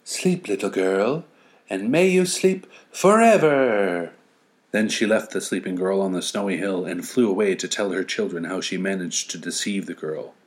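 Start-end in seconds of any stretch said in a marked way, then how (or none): 0.0-4.1 slow, sinister witch croon, rising high; gloating winter witch lulling her victim. Acted. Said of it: "grinned in delight".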